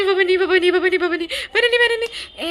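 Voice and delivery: high, loud wailing plea to dad; squealed, half-laughing imitation of frantic crying; mock terrified pleading. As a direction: beg in a hammed-up crying panic. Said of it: "ऐसा ही reaction था मेरा".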